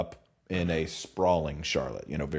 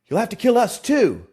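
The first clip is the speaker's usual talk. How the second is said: high, loud defiant yell; 12 semitones higher, 8 dB louder, faster than usual. Acted. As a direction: yell it at him, pitched way up.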